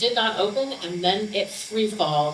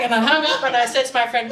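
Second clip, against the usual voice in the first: ordinary talk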